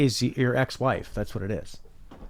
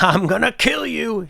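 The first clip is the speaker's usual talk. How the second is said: villain threat; 10 semitones higher, 3 dB louder, slower than usual. Retold or imitated. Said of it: "And she's like".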